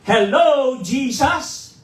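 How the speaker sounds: loud, high yell of Jesus' name; shouting at the top of his voice. Retold or imitated. Pastor testing his voice after surgery, full yell. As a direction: belt it out to test the voice. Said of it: "sumigaw ako talaga on top of my voice".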